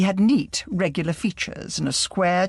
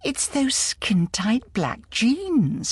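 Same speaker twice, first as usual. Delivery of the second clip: slow rueful Eastern whisper of regret; 7 semitones higher, much slower than usual. A narrator's reading. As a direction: breathe it out slowly, ruefully. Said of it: "said Agatha ruefully".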